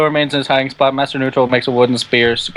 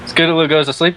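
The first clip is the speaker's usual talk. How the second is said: usual speaking voice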